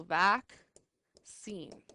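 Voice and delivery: vaccine slowed right down, vac and cine pulled apart; split into syllables. Shown as a demonstration. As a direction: stretch vaccine out slowly syllable by syllable. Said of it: "split up the double letters".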